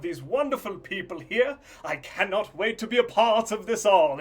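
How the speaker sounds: high, loud, over-the-top host welcome; flamboyant show host. Acted. The host welcomes the contestants with loud showman flair. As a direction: sell it like a showman hyping the crowd.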